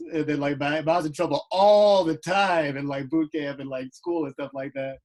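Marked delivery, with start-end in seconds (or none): 1.5-2.8 "all" drawn out and louder; loud, stretched "all" for exaggeration; stress on ALL. Real, not acted. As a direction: lean hard and long on "all". Emphasises how often in trouble.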